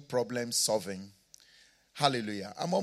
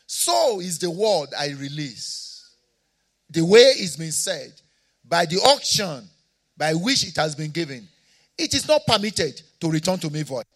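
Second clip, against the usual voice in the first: raised, louder preaching voice; fervent preaching; 4 semitones higher, 7 dB louder than usual. Real, not acted. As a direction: press the promise home to the crowd. Preaching builds again.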